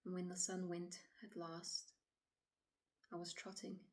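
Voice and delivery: quiet, halting dramatic monologue, long pauses; troubled woman recounting. Acted. Woman telling officer of walking alone at dusk, uneasy and hushed. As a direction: relive it softly, leaving silences between phrases.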